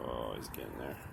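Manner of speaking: low hushed whisper; whispering over a crib. Real, not acted. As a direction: murmur softly beside the sleeper. Pet falling asleep, keeping quiet.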